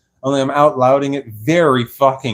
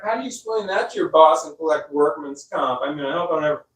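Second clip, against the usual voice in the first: usual speaking voice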